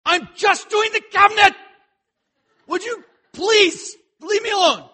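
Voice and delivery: loud, high, exasperated outburst; lashing out. Retold or imitated. Husband fixing a cabinet, lashing out at his pestering wife. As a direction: snap at her to be left alone. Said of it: "I will lash out and just be like".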